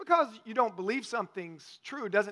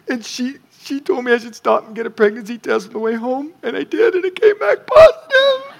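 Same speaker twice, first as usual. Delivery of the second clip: retold sobbing quote, rising to a high loud wail; imitating hysterical crying; 4 semitones higher, 14 dB louder than usual. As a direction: mimic his wife crying down the phone. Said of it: "she's crying hysterically".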